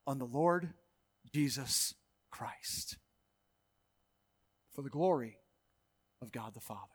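hushed awe; reverent hush. Real, not acted. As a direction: draw them in with a whisper. Hushed focus on Jesus.

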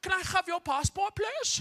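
high-pitched German border official; singsong German-accented English. Retold or imitated. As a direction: imitate a German passport officer, rising on the request. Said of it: "I get there and the guy's like".

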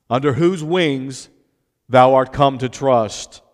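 slow, declaimed Bible verse; scripture reading. Acted. Preacher reads Boaz's blessing on Ruth, declaimed scripture reading. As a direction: proclaim the verse with deliberate weight.

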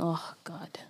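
breathy unvoiced dismay; whispered oh god. Real, not acted. Groaning at the mention of the UK accent, whispered. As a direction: whisper it under your breath, exasperated.

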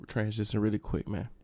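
ordinary talk

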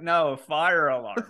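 "fire" hit slow and firm to correct him; slow, spell out "fire" like to a confused old man; stress on fire. Retold or imitated. Correcting the old man at the theater, "fire" pressed slowly. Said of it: "I emphasize fire just so he knows".

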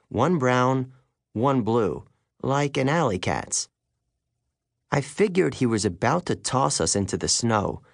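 ordinary delivery

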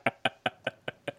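short voiceless laugh; real chuckle. Real, not acted. Guest laughs off being on call all day, short voiceless chuckle. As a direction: let a brief, airless chuckle out. Laughs off his unfinished explanation.

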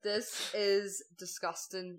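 very slow, word-by-word disgust. Retold or imitated. Guest about to be sick from soup, disgusted. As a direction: drag out each word in revulsion. Retelling her reaction to awful soup.

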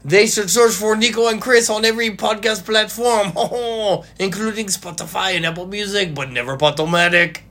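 high-pitched cartoon French accent with a "hon hon" laugh; mock French accent. Co-host telling where to find the show, mock French accent. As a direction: ham up the plug as a silly Frenchman. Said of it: "doing French accents for everybody's voice".

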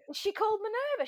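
high, puzzled "Is she called Minerva?"; surprised question. Retold or imitated. Asking her friend about the name, high and surprised. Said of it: "And I went".

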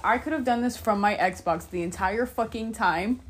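loud frustrated rant; exasperated outburst. Real, not acted. Realizing she could have used her Xbox, loud and exasperated. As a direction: vent it at full volume.

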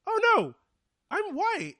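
high, laughing smug relief; smug realisation. Retold or imitated. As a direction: drop it with breezy privilege. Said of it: "and then remembers".

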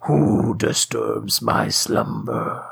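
low, slow, booming cave voice; grinding stone statue. Acted. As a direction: rumble it like a giant stone head. Quoting the Cave of Wonders.

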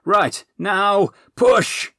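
loud high command building to a yell; urgent shout. Acted. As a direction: drive them into the final push. Urgent orders during struggle.

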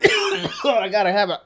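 talking through coughs; coughing. Real, not acted. Host wishing for a cough button, coughing as he talks. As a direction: cough hard between the words. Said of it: "cough button like Joe Rogan".

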